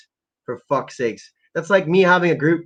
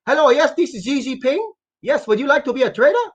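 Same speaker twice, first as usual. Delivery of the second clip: higher, put-on mock Chinese leader on the phone; mock Xi Jinping; 10 semitones higher than usual. Streamer fakes a call from the Chinese leader, higher put-on voice. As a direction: ham up a leader making a recruiting call. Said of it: "I'll make a call with Xi Jinping".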